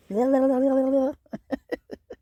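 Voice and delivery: sudden high giggle; high burst of laughter. Real, not acted. Laughs after shutting up to read.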